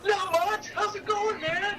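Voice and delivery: match the rock star's hype; matching his energy. Retold or imitated. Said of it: "you kinda have to match [name]'s energy".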